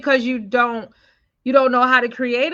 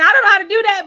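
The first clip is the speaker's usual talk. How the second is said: excited quote; 9 semitones higher, 8 dB louder, much faster than usual. Retold or imitated. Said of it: "One is like".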